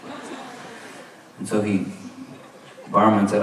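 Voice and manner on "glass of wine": hushed plea fading into a voiceless whisper; begging. Retold or imitated. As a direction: imitate a desperate low-key plea to a barman. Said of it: "he was just begging the barman".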